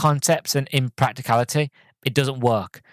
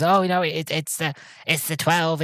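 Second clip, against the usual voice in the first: higher, stalling vampire voice; lightly mimicked evasive vampire; 5 semitones higher than usual. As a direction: put on a hint of a vampire fumbling an answer.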